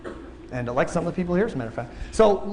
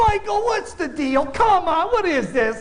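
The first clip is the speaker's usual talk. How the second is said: mock whiny complaint; 9 semitones higher, slower than usual. Coworker complaining about the antlers.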